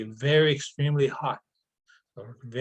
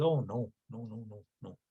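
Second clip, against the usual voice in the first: winded, quiet and breathless; out of breath; 3 semitones lower, 9 dB quieter than usual. Acted. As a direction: gasp it out after a deep dive. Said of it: "he came back out of breath".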